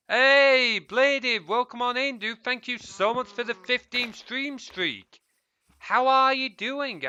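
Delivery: high, loud cheerful welcome; light up to greet a new viewer; excited greeting. Real, not acted. Welcoming the viewer Bladed on stream, high and loud.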